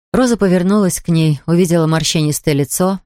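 usual speaking voice

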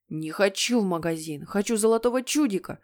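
ordinary delivery, nothing marked

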